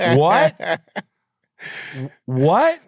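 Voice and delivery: sneering parody of someone who didn't hear; mocking drawn-out what. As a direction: ham up a dumb What?. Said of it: "I hate when people say".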